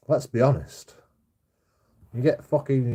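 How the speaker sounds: normal talking voice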